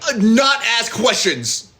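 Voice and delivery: evasive answer. Retold or imitated.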